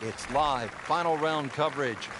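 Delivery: slow, high, grand announcer read. Acted. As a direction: present it like a big TV broadcast open. Game intro announcement.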